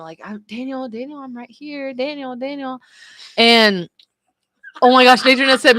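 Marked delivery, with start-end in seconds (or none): 0.2-2.8 softly coaxing, pitched up; gentle reassurance. Retold or imitated. Wife rubs his arm to rouse him, gentle coaxing. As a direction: soothe him awake in a soft, higher voice. Retold soothing of groggy husband.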